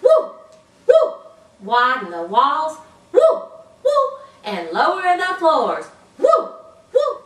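high, loud party woo; raise the roof. Shown as a demonstration. Teacher leads raise-the-roof cheer: high whooping woo. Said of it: "Let's raise the roof".

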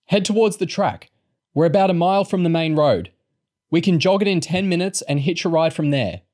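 high-pitched, urgent instructions; ordering. A narrator's reading. Girl directing stretcher bearers to the road, ordering. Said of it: "Lauren ordered".

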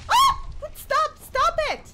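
high, loud screamed pleas; panicked screams. Real, not acted. Streamer cornered in the game, screaming stop.

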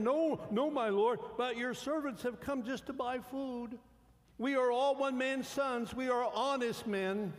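high, loud, desperate protest; pleading brothers. Acted. Brothers protest their innocence to Joseph, high and pleading. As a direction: beg the lord to believe you.

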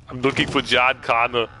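lightly voiced robotic Schwarzenegger; a hint of Terminator menace; Terminator impression. Gamer playing Terminator for chat, light accent. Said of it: "impression of the Terminator".

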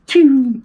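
very high, loud pew of a passing bullet; cartoon bullet whizzing by; bullet whiz. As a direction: fire off a sharp high pew like a bullet past the ear. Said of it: "It went".

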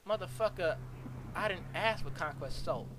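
normal talking voice

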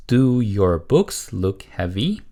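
slow, word-by-word model question; model reading. Shown as a demonstration. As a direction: read it slowly for learners to repeat. Teacher models sentence for repetition. (천천히 또박또박 읽는 시범)

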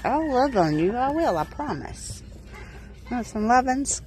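ordinary delivery

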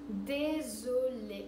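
Désolé stretched very slow, syllable by syllable; slow-motion Désolé; drawn-out désolé. Shown as a demonstration. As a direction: draw Désolé out for the learner. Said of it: "Désolé, je ne comprends pas".

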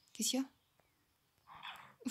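slow, drawn-out eerie whisper; slow spooky whisper. Pretend. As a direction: whisper it slowly, like a ghost. Creepy whisper in the game.

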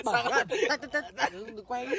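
high-pitched laughing protest. Real, not acted. Laughing, begging camera stop filming.

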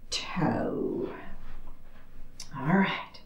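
breath-only whisper, drawn out; whispered cue. Real, not acted. Instructor finishing a heel-toe cue to her class, whispered. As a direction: let the last cue drop to a whisper.

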